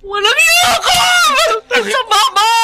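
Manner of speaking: high sobbing screams of betrayal; crying shout. Acted. Betrayed wife screams at her husband through tears.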